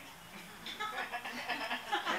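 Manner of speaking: short amused laugh at her own joke. Real, not acted. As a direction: laugh it off lightly.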